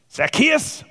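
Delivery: sharp high angry cry of the name; angry disbelief. A narrator's reading. Father hearing the tax man's name, angry high cry. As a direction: spit the name out, shocked and furious. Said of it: "sudden anger".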